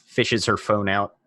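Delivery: ordinary talk, nothing marked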